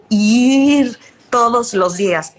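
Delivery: "fluir" stretched out very slowly; drawn-out emphasis. Real, not acted. Host telling a new mother to go with it, "fluir" drawn out. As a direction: hold "fluir" long, then finish the phrase.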